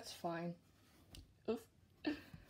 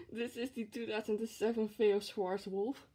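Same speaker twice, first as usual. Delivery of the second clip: ordinary talk, nothing marked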